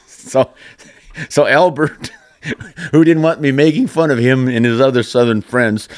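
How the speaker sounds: real chuckling. Real, not acted. Host relaying a spelling jab to listeners, chuckling. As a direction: laugh your way through the setup.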